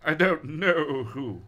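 high, loud demanding cry; hammed-up panicked who-are-you; alarmed questioning. Acting out scared character's lines.

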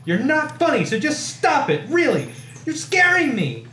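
high, loud, frantic pleading; screaming. A narrator's reading. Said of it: "I screamed".

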